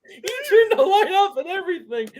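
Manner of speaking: high, laughing disbelief. Real, not acted. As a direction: choke it out through laughter. Laughing at Noah leaving.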